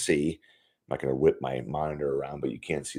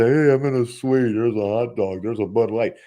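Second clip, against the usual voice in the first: louder, higher, mock-impressed suite guest; mock fan bragging; 4 semitones higher, 4 dB louder than usual. Mocking fans who go to the suite for hot dogs, mock-impressed and louder. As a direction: show off like a fan in a fancy suite for the first time. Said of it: "was not to".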